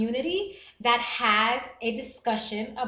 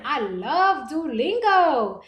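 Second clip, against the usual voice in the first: slow, sing-song, louder declaration of love; gushing enthusiasm; 3 semitones higher, 6 dB louder, much slower than usual. Real, not acted. Streamer gushing over language app, drawn-out enthusiasm. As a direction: stretch every word out, swooping with delight.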